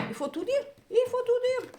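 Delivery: high-pitched, climbing exasperation; exasperated outburst. Real, not acted. Reader exasperated at having to spell everything out, high outburst.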